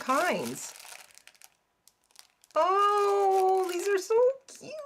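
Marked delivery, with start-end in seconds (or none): 2.5-4.7 excited. Real, not acted. Cooing at trees.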